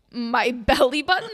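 loud, laughing retold disbelief; incredulous. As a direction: blurt the question back in mock shock. Retells her own shocked reply.

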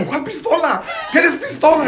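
high mock cop question broken by laughter; laughing cop imitation. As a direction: imitate the officer while cracking up.

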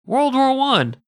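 World War One thrown out high and loud; mock it like the topic was never covered; a sarcastic jab at a well-worn topic; sarcastic emphasis.

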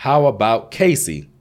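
shouted. Acted. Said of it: "shouted Zalea".

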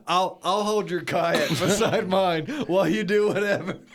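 joking offer broken up by real laughs; laughing. Real, not acted.

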